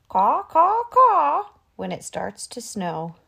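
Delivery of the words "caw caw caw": high, loud cawing; crow caw. A narrator's reading. Narrator doing the crow's high, loud caws.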